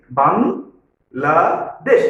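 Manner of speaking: বাংলাদেশ in three drawn-out syllables, pausing between each; teacher slowly splitting বাংলাদেশ into its three syllables. Shown as a demonstration. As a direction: say বাংলাদেশ very slowly as বাং, লা, দেশ. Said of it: "তিনবার থামতে হয়েছে".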